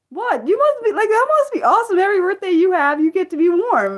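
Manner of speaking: high, loud amazed gush; excited gushing. Real, not acted. Streamer to Australian viewer about warm birthdays, excited. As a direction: marvel out loud.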